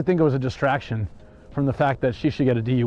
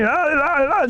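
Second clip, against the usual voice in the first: high mock wailing squeals; hammed-up high-pitched wails; frantic squeals; 12 semitones higher, 3 dB louder than usual. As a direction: squeal like a hysterical drunk woman. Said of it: "She's just losing her".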